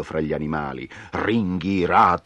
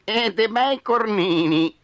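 high, slow, loud bleat; bleating sheep; 4 dB louder than usual. Acted. Sheep bleats for horns, high slow bleat. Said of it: "belò Pecora".

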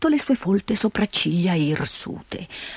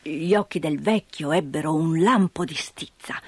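ordinary delivery

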